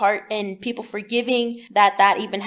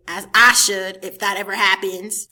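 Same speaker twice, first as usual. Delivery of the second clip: ordinary delivery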